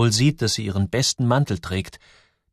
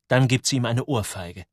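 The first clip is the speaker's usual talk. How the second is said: ordinary delivery, nothing marked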